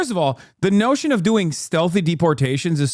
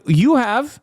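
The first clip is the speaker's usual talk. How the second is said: high, put-on voice of a bullying agent; a higher mock officer accusing a stranger; mocking ICE agent; 6 semitones higher, slower than usual. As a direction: sneer it like a dumb agent. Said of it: "going up to random people and being like".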